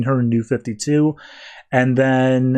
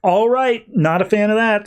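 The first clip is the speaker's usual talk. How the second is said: pissed off; 8 semitones higher than usual. Retold or imitated. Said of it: "kinda gets a little bit pissed".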